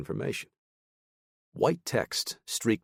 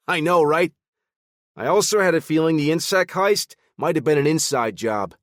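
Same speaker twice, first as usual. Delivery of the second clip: chipper, animated; 10 semitones higher, 8 dB louder than usual. Acted. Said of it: "chipper and animated".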